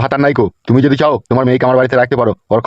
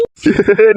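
laugh; 6 semitones higher than usual. Acted. Husband laughs at wife.

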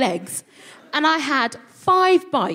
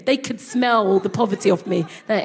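ordinary talk, nothing marked

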